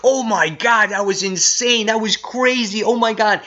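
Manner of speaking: high-pitched gushing reaction, imitated; gushing amazement. Reviewer mimics being floored by the comic, high-pitched gushing. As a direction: act out being blown away.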